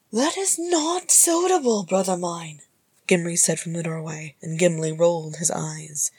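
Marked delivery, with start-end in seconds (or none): 0.1-2.6 high, slow, reproving woman's voice; high-pitched sisterly objection; disapproving sister. A narrator's reading. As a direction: chide a brother from the doorway. Sister scolds from the doorway.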